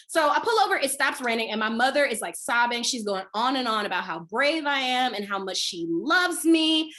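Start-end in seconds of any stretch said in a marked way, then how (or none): none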